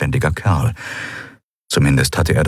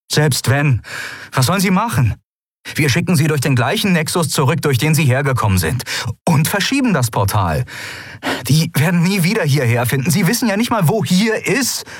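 higher, hurried nervous arguing; anxious Sephiron arguing; 10 semitones higher than usual. A narrator's reading. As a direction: talk fast to cover your nerves. Sephiron argues desperately.

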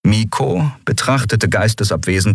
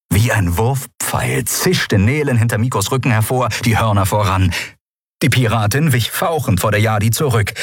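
plain reading voice

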